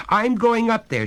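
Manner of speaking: high loud frightened cry; trembling cry. Acted. Betty crying she will go upstairs, frightened and loud. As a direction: rush the words out, shaken. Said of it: "she cried, trembling".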